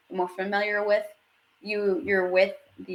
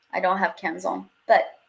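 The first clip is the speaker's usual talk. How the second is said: ordinary talk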